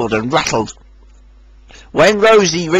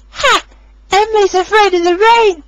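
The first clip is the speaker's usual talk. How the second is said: high laughing engine voice; laughing to herself; 3 dB louder than usual. A narrator's reading. Rosie laughing at Emily to herself, high. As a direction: giggle at Emily's fear. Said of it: "she laughed to herself".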